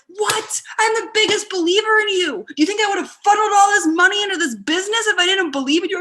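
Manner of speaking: high, loud, fast disbelief; incredulous husband. Retold or imitated. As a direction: protest as if shocked by the charge. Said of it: "He'd be like".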